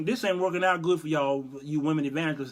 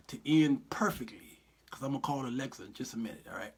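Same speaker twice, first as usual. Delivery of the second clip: whisper; 4 semitones lower, 6 dB quieter than usual. Pretend. Whispered aside to Jesus.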